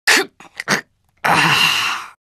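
choked grunt, then voiceless exhale; pained gasp. Acted. Fighter winded by a blow, pained gasp. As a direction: gasp out the breath in pain. Recorded damage reaction sounds.

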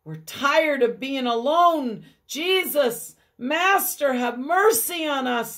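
high, loud begging plea; lonely lepers calling to Jesus; pleading cry. Acted. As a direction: beg for mercy. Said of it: "they begin to cry".

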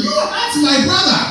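hammed-up indignant African protest; mad African man. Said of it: "My brother was mad at me".

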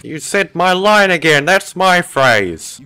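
high, loud, mock-outraged complaint; indignant protest. Real, not acted. Player to character who took their catchphrase, indignant. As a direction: protest loudly at being copied.

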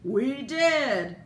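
mock commercial announcer; proud announcer. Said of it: "the unseen announcer proudly pronounces".

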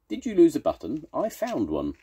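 high-pitched little bird; sparrow voice. A narrator's reading. Sparrow offering Toad a button, high and chirpy. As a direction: pipe up high and helpfully.

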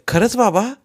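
put-on eager child. A narrator's reading. Young son to his father, eager and surprised. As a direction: ask with sudden childlike eagerness.

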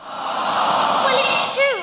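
loud defensive outburst. Acted. Sudden loud high insistence.

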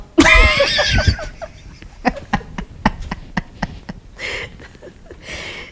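long helpless laugh; big real laugh. Real, not acted. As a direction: crack up at the memory. Bursts out laughing at the story.

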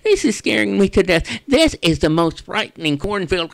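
slightly high, fast frightened crow; a crow spooked by scarecrows; lightly voiced scared crow. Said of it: "looked down and said".

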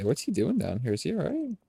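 ordinary talk

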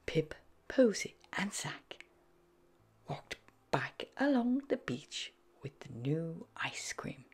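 soft hushed bedtime-story read; gentle whispered narration; whispered storytelling. A narrator's reading.

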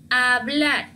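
hablar drawn out from a plain a, "ablar"; no h at the front of hablar; silent H. Shown as a demonstration. Teacher models for learners, hablar with silent H. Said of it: "Solo hablar".

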